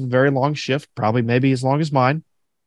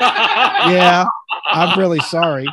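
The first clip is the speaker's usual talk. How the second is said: laughing apology; 3 semitones higher, 3 dB louder, much slower than usual. Retold or imitated.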